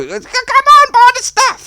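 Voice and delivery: squealing very high and loud; desperate Miles. Retold or imitated. Miles begging Jeff to buy, in a very high, loud squeal. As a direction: shriek the plea like a desperate salesman. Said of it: "I was like".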